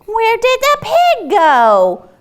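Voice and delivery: high, loud, slow worried call; crying out. Acted. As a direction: call out for the lost pig. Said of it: "the animals cried".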